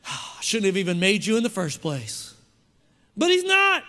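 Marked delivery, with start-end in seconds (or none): 0.1-2.3 low, grumbling mock God; vitriolic God. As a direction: growl it like a disgusted God. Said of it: "would be, like, vitriolic".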